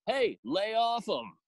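retold call to back off. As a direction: imitate an audience member objecting, slowly. Heckler calls out from the back.

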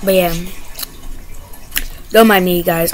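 ordinary talk

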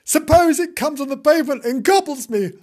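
high, loud worried kitten; fretful cry. Acted. Kitten fearing the car will gobble him, panicked. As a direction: fret aloud in a high wail. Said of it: "cried Timbo".

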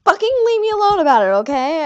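fast, high, loud outburst; exasperated yell. Retold or imitated. Gamer retells snapping at friends who corrected him, loud and fast. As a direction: blast it like you're fed up. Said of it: "And I was like".

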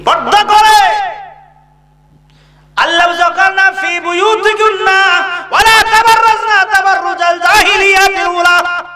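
high, ringing exhortation; sustained high-pitched preacher's call; forceful preaching. Real, not acted.

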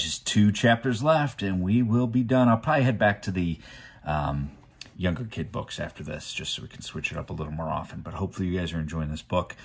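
usual speaking voice

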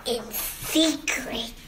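soft, slow, secretive; hushed voice. A narrator's reading. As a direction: let them in on a secret, hushed. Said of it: "in a hushed voice".

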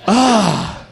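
ordinary talk, nothing marked

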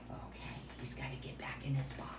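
quick, soft near-whisper; hushed. Pretend. Host putting Mister Turtle back in his box, hushed.

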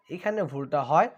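ordinary delivery

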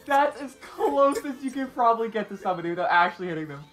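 high-pitched talking through laughter; laughing hard. Real, not acted. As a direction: squeeze the words out through real laughter. Cracking up after a near miss.

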